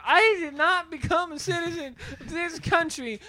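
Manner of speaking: high, loud mock outrage; mock-affronted citizen, pitched high; outraged complainer.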